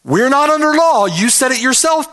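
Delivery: high-pitched protesting opponent; indignant objector pitched well up; objecting antinomian. Acted. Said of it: "the antinomian has the opposite concern".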